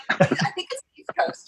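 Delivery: laughed through, breathy giggle; amused laughing answer. Real, not acted. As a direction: laugh out the correction. Laughing at host's West Coast joke.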